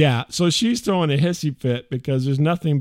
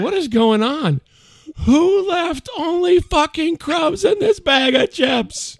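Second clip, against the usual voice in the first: high, slow mock rant of the wife; hammed-up cussing wife, pitched high; angry wife; 13 semitones higher, 5 dB louder, slower than usual. Wife ranting about chip crumbs, high mock fury. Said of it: "I'm like".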